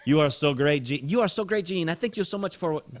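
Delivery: high mock gushing, no British accent; gushing thanks. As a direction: gush like a grateful fan. Acting gushing Vicky, first attempt.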